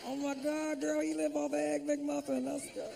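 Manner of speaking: very high-pitched mock female voice; be impressed in a high, girlish imitation; smitten woman. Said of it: "walk up to a woman".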